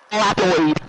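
loud catchphrase burst in a put-on voice; mock shout. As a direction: blurt the nickname out loud in a silly voice. Shouting gamer catchphrase over chat.

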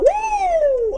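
high joyful whoop; excited whoop. Retold or imitated. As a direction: cheer like leaving a conference on fire. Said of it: "I'm like".

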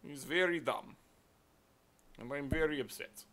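put-on Russian accent, mock upset. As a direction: complain in a jokey Russian accent. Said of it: "I'm suddenly Russian".

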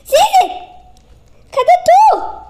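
being chased. Real, not acted. Calling friend during escape.

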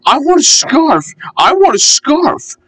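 very high chanted puffing complaint; puffing little engine. A narrator's reading. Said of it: "still puffing".

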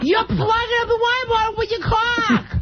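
put-on Massachusetts accent. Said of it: "How do you say C A U L K in Massachusetts".

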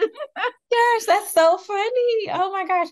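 high, loud, amused exclamation; highly amused. Real, not acted. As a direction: exclaim it, tickled by the failed attempt. Cracking up at failed accent.

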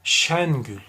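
ordinary delivery

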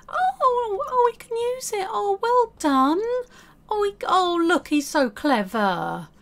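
high, squealing delighted praise; excited praise. Real, not acted. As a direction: gush over him like a proud parent. Praising her Sim's success.

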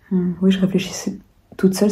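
hushed whispered repeat; whispering. Real, not acted. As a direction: whisper "je réfléchissais toute seule".

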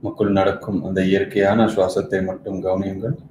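soft, measured meditation guidance; quiet meditation-coach voice with long silences between instructions; guided meditation. Acted.

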